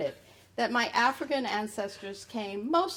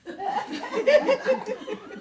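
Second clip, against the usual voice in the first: high laugh; 4 dB louder than usual. Real, not acted. Laughs after "I'm Irish.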